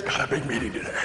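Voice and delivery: low, soft, tense businessman voice; uptight businessman. Retold or imitated. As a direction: mutter it like a stiff, busy executive. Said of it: "the epitome of somebody who was uptight".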